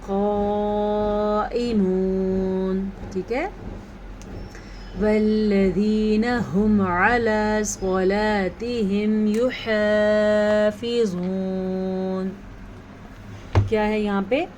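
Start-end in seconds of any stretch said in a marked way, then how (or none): 0.1-2.9 قائمون with a heavy qaf, madd held long; six-count madd. Shown as a demonstration. Teacher answering how to hold the madd. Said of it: "کیسے؟". 5.0-12.3 slow melodic tajweed chant; Quran recitation. Shown as a demonstration. Teacher reciting the next verse for students.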